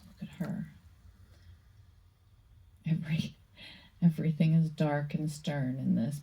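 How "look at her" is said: awed whisper. Real, not acted. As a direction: breathe it in hushed awe. Whispered awe at card.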